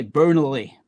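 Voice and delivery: Lancashire accent with a rolled-out R in "Burnley"; Lancashire burr. Shown as a demonstration. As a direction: say "Burnley" with a strong Lancashire R, drawn out. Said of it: "the burr in Lancashire".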